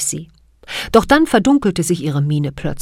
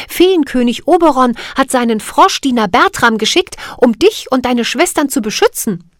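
put-on raised, higher eager girl; young girl's voice; 6 semitones higher, 5 dB louder than usual. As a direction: speak up like an eager little girl. Cathy voiced in story.

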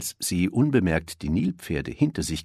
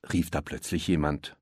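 plain reading voice

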